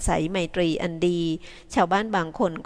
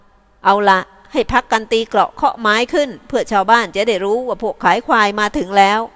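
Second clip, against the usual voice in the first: calling out orders; 3 semitones higher, 8 dB louder than usual. A narrator's reading. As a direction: order the men to rest and beat the drums. Drover gives orders to camp.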